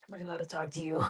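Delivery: nervous hushed question. Retold or imitated. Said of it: "And I was like".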